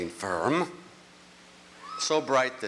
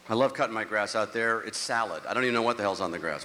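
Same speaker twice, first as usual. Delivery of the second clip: ordinary talk, nothing marked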